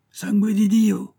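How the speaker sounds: long ringing yell; yelling full-lunged. A narrator's reading. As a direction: howl it slowly at high pitch. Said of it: "hurla-t-il de toute la force de ses poumons".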